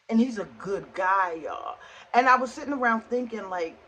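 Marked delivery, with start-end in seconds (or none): none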